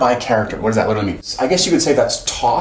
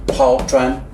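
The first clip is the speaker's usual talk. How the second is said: usual speaking voice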